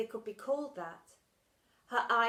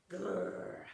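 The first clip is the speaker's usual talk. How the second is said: creature growl; 2 semitones lower than usual. Acted. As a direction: growl like a beast.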